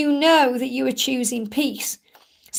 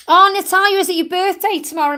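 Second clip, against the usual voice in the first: excited; 5 semitones higher, 4 dB louder than usual. Real, not acted. Notices viewer's birthday.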